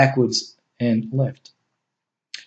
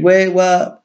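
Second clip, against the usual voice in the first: quivering voice; 6 semitones higher than usual. A narrator's reading. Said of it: "his voice still quivering uncontrollably".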